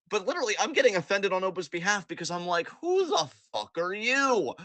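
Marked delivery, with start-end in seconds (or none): 2.8-4.5 higher, slow, indignant retort; with all the tone. Retold or imitated. Offended fan voicing a retort, slow, indignant. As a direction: sneer it out, offended for Oba. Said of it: "With all of the tone".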